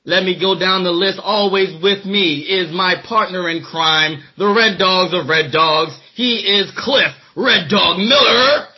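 usual speaking voice

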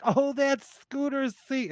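put-on high, slow amused old lady; grandma voice. Grandma tells narrator it's the bird's seat, high amused. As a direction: play it sweetly amused. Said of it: "And she's like".

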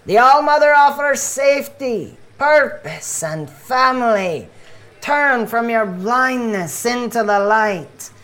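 fervent doomsday preaching, voice lifted; street preacher. Acted. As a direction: proclaim doom and salvation to passers-by. Street preacher's repeated spiel.

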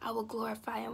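usual speaking voice

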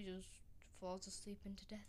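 hushed whisper. Real, not acted. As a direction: whisper it like a secret. Quiet dramatic description.

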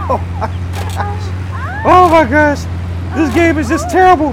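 high-pitched squealing disbelief; strained high exasperated wail. Real, not acted. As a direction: squeal up high at how bad it is. Exasperated at absurd game mission.

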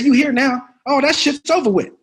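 loud, high, impatient pep talk; Sam Jackson. Retold or imitated. Star urging a nervous rookie through the scene, impatient. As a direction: imitate a star pushing a rookie onward. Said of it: "He said".

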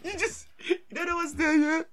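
high-pitched giggly voice; laughing. Real, not acted. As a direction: giggle through the words.

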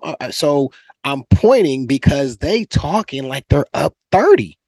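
ordinary delivery

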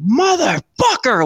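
high, drawn-out scream of pain; mock pained scream; retold scream. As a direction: yell it out long as the balloon goes in. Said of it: "and I screamed".

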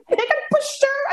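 said through high laughter; laughing. Real, not acted. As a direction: giggle out the admission. Laughing about her pushiness.

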